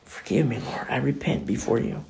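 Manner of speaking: hushed repentant prayer; whispered prayer. Pretend. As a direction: whisper a humble confession to God.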